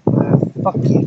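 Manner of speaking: fading whispered curse at the thief. Real, not acted. Cursing the thief who put his shaving cream back, whispered. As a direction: spit it out in a whisper.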